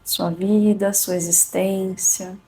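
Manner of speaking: calm and unhurried, long pauses; soothing spa whisper. Acted. Leads a guided meditation.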